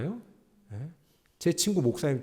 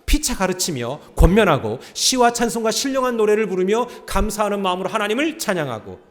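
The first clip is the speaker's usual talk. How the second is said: loud, raised reading of the verse; scripture read aloud; 8 semitones higher, 10 dB louder than usual. Acted. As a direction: lead the congregation in reading. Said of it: "시작". (회중과 함께 크게 읽는 성경 봉독)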